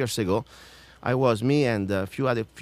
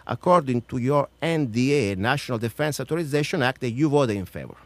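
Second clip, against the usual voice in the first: emphatic, raised reading voice; formal read-out challenge; 3 semitones higher, 3 dB louder than usual. Acted. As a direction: read out a pointed question to a senator. Reads out numbered question.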